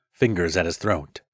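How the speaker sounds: ordinary delivery, nothing marked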